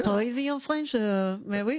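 high, playful Parisian French accent. Shown as a demonstration. Coach naming his Parisian French, French accent. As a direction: tease in a put-on French accent. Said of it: "the center of placement of his accent".

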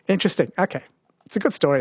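normal talking voice

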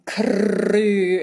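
"crew" with a long, loud trilled R; rolled R. Shown as a demonstration. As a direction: trill the R in "crew" at exaggerated length. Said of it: "really roll their R's".

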